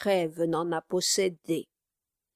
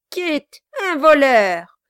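loud high exclaim; mock outrage; 7 semitones higher, 10 dB louder than usual. Quilp cries out over Kit, mock shock. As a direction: feign shock at the news. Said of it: "s'écria le nain".

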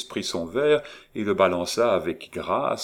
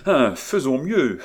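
quick, higher, louder rush; impetuous; 6 semitones higher, 5 dB louder than usual. Acted. Étienne rushing on, carried away by ideas. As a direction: blurt it out, ideas boiling. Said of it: "se reprenant avec impétuosité".

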